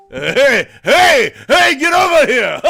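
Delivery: loud, high shouted talking-seal impression; blustery mock holler, rising in force; Hoover. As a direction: bellow like a drunk Maine pirate. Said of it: "And then Hoover looks at him and goes".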